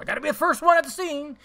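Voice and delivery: a high, loud hammed-up Nightcrawler reporter; Nightcrawler Jake Gyllenhaal. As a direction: play the frantic stringer racing to the scene. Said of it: "I'm like fucking Jake Gyllenhaal in Nightcrawler".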